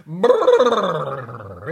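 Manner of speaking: frozen father shivering; cold shiver. Acted. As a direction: shiver out of the icy water. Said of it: "it's cold".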